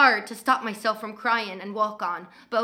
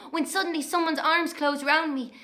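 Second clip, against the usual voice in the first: ordinary delivery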